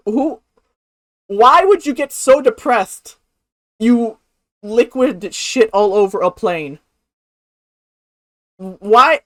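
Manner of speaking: loud, baffled questioning; ranting in disbelief; incredulous rant. Real, not acted. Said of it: "baffled loud rant".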